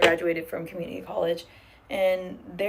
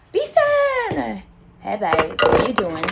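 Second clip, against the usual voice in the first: high, loud calling out a name; a joyful high yell of welcome; excited greeting shout; 3 semitones higher, 8 dB louder, slower than usual. Real, not acted. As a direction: sing out the viewer's name in delight. Greeting a chat viewer by name.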